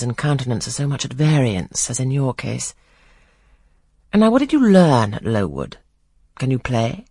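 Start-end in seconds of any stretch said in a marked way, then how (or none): none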